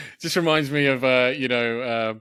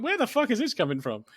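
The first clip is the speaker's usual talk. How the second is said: incredulous; 10 semitones higher, 4 dB quieter than usual. Retold or imitated. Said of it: "I'm like".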